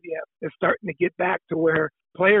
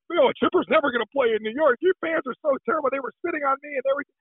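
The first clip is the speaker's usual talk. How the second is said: higher, hurried indignant retelling; complaining wife; 7 semitones higher, faster than usual. Retold or imitated. Player's wife griping about New York fans, mock aggrieved. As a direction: imitate an aggrieved woman complaining.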